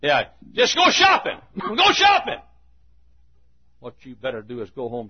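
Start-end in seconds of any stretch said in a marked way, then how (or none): 0.5-2.4 high, loud mocking cry; mocking call. Real, not acted. Preacher scoffs at those shopping for a sissy, mocking high cry. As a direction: sing it out high, punching SHOPPING.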